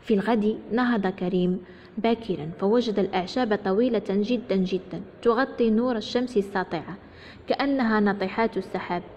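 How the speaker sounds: ordinary delivery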